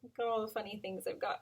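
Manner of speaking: ordinary delivery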